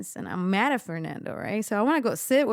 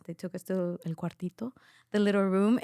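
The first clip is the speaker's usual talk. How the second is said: ordinary talk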